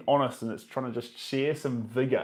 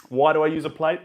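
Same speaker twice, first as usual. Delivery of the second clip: louder, lively echo of the question; bright repeat; 4 semitones higher, 7 dB louder, faster than usual. Real, not acted. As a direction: bounce the question back with energy.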